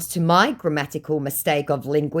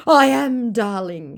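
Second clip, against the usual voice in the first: mock declaration; 6 semitones higher, 4 dB louder, slower than usual. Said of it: "he declares".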